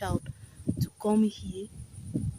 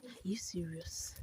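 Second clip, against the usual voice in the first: much softer unvoiced whisper; whispered disbelief; 3 semitones lower, 18 dB quieter than usual. Real, not acted. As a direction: breathe it out in shock. Stunned at the ravine.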